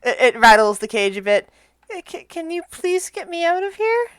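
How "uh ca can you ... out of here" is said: high, soft little creature voice; timid flying monkey. Acted. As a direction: plead softly as a frightened little monkey. Monkey pleading from cage.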